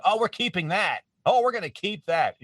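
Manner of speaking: high, emphatic delighted reaction; mock excitement. Colleagues reacting to an ad-libbed throwaway line, mock excitement.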